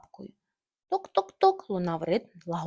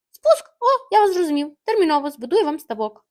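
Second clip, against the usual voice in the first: much higher and louder, excited; eager surprise; 7 semitones higher, 9 dB louder than usual. Acted. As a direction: jump in with eager glee. Tim excited. (дуже високо й голосно, захоплено)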